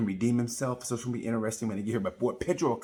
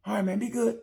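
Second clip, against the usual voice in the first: gentle goodbye; 12 semitones higher than usual. Retold or imitated. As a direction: say goodbye kindly in a raised voice. Leaving sulking friend.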